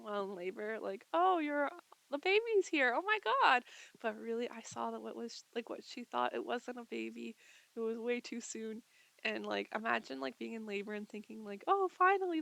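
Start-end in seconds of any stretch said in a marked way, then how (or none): 1.1-3.6 higher, louder mock-excited exclamation; imitated breathless thrill; excited announcement. Preceptor announcing baby to client. 11.7-12.4 higher, louder "oh, finally"; mock elated sigh of relief; imagined relief. Imagined relieved labouring client.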